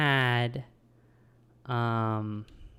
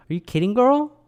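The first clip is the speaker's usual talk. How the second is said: much higher and louder, sassy; mock outrage; 11 semitones higher, 5 dB louder than usual. Real, not acted. Scolding Arthur's sister to viewers, mock outraged.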